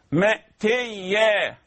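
مأتيا very slow; careful recitation. Shown as a demonstration. Teacher recites مأتيا slowly for students. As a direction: draw out مأتيا.

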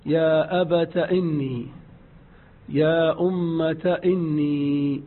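"أبت" and "أمة" with ta ending in fatha. Shown as a demonstration. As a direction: open the final ta. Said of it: "تاء مفتوحة". ("أبتَ" بتاء مفتوحة)

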